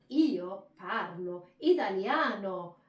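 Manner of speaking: slow, loud "io parlo italiano", every word stressed; emphasized Italian. Shown as a demonstration. Teacher shows student emphasis, "io parlo italiano" loud and slow. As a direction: roll "io parlo italiano" out in big waves. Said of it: "We emphasize".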